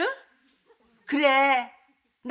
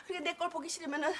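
ordinary talk, nothing marked

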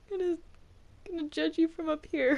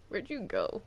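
ordinary talk, nothing marked

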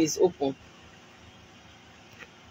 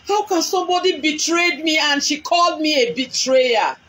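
high, raised voice of a betrayed woman; angry outcry; 6 semitones higher than usual. Real, not acted. Woman on Facebook live accusing her betrayer, hurt and angry. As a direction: cry out the hurt and anger.

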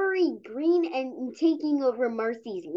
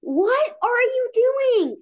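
much higher, slow shocked question; horrified child voice; stunned cry; alarmed kid; 9 semitones higher, 3 dB louder, slower than usual. Acted. Kid catches laser.

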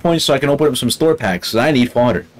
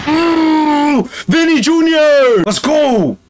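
high, drawn-out cheer for Vinny Junior; excited cheer; 12 semitones higher, much slower than usual. Real, not acted. Gamer cheering his striker's run, high and drawn out. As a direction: cheer him on as he breaks away.

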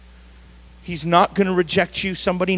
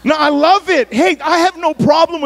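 excited insistence; 11 semitones higher, 5 dB louder than usual. Real, not acted. Preacher insists he loves it.

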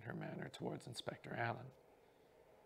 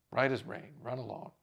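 loud, breezy brush-off; hearty actor dismissal; 8 dB louder than usual. Acted. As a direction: send him off cheerfully. Gardner dismisses dresser.

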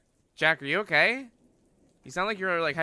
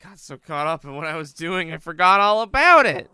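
rising panic, louder and higher; panicked; 4 semitones higher, 14 dB louder than usual. Real, not acted. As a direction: freak out, voice climbing. Panicking about forgetting class.

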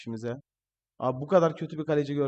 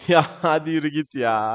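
laughing it out, louder; 7 dB louder than usual. Real, not acted. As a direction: cheer him on through a laugh. Laughing at a run on goal. (gülerek, daha yüksek sesle)

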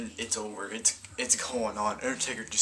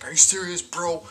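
higher, louder imitation of an outraged yell; outraged shout; 6 semitones higher, 9 dB louder than usual. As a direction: yell it at the referee in disbelief. Zack Ryder outraged at referee.

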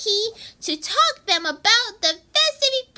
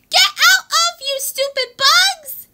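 high, raised shooing shout; pretend angry shout; 5 semitones higher, 5 dB louder than usual. Chasing stupid bugs out, pretend angry shout. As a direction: play at yelling the pests away.

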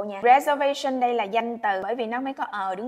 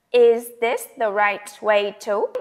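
slow model line; 6 dB louder, slower than usual. Shown as a demonstration. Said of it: "teacher models the question".

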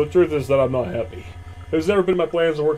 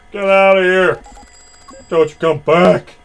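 shouting; 4 semitones higher, 9 dB louder, slower than usual. Acted. Cashier chasing thief away.

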